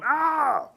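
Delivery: high, drawn-out "aaah"; mock turtle scream. Turtle tossed around by wolves, comic high yell. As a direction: hold a high cry as if being tossed about. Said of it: "feet come out going".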